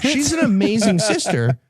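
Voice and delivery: ordinary talk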